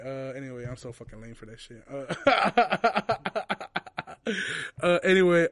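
ordinary talk

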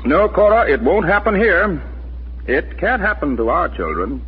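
adamant, reassuring protest; emphatic denial. Acted. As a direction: firmly rule it out, voice lifted. Husband insisting it can't happen here.

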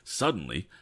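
usual speaking voice

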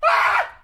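high theatrical cry before a lyric; dramatic shout. Acted. Friend reading a song lyric, theatrical high cry. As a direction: throw out a big wailing ah.

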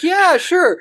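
very high eager reply; eager Phil. Retold or imitated. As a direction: squeak it out eagerly. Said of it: "He's like".

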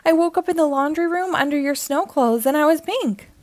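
high, mock-tearful wail. A narrator's reading. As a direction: lament it high, a touch tearful, as a storybook toy. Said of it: "Chili starts to cry".

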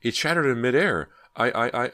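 high, loud, breaking off in stutters; panicked stammer. A narrator's reading. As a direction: gasp it out, stuck on I. Ginny still screaming, stammering.

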